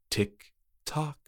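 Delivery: very soft, eerie; taunting tick-tock. Acted. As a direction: hurry her creepily. Trick-or-treater taunts.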